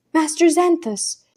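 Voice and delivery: can't believe he is here; incredulous exclaim. A narrator's reading. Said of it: "Claudia exclaimed incredulously".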